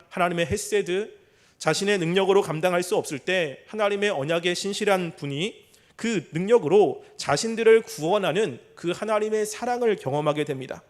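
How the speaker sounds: ordinary delivery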